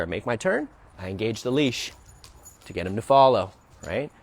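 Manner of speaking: normal talking voice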